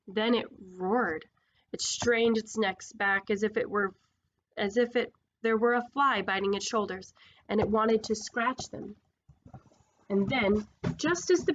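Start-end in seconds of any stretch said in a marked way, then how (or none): none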